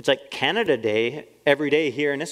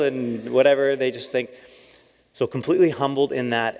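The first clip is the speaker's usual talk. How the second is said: usual speaking voice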